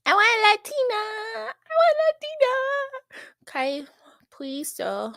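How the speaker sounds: high, whiny mock begging; hammed-up pleading mimic. Streamer mocking a love-struck contestant, hammed-up pleading. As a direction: beg in a put-on childlike whimper. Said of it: "he's just like".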